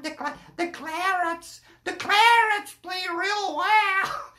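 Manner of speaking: slow, drawn-out mock American accent. Fan imitating the American Clarets fan, mock American accent. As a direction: stretch it out in a put-on American accent. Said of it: "when you hear 'em talking like".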